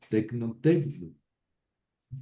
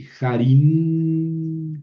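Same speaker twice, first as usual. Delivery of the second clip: JARÍN with the final N held long and loud; drawn-out, louder ending on jarín; emphasis on final N; 5 semitones higher, 7 dB louder than usual. Shown as a demonstration. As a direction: lean hard on the end of jarín and stretch it out. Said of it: "cuidado con esa ni".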